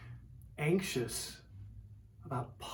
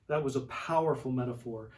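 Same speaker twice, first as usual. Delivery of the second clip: ordinary talk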